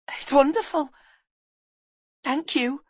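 put-on high, wonder-filled boy's thanks; delighted boy. Boy thanks woman for his room, delighted. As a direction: pitch it up in grateful delight.

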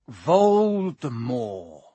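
very slow, drawn-out dread; slow, fearful naming. Acted. Hagrid forces out feared name.

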